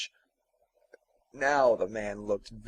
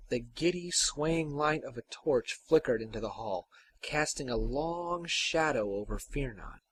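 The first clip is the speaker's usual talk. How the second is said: plain reading voice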